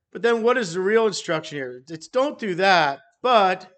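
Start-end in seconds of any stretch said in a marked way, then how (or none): 2.1-3.7 BUT hit loud and set apart; stress on BUT. Shown as a demonstration. Preacher pointing congregation to the real instruction, emphatic BUT. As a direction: punch "but" hard, then pause.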